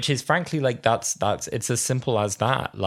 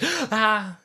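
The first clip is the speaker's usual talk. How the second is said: mock pained gasp.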